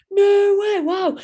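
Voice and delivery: high, loud mock amazement; amazed locals. Regulars at the pub hearing about the row, high excited squeal.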